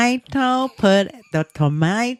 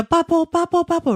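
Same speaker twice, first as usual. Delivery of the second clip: higher, lightly voiced toddler babble; imitating baby; 8 semitones higher, faster than usual. Mother imitating her baby repeating a word, higher. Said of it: "baby Belle goes like".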